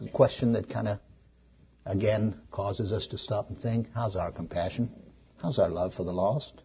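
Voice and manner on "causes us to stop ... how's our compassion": ordinary talk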